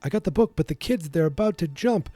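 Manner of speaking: high, hurried imitation of a frantic teen; panicked Marty. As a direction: squeak it out like a kid in a jam. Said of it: "and he's like".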